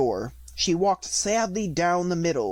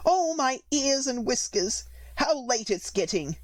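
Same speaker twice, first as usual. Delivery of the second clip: fretful rabbit; 10 semitones higher than usual. A narrator's reading.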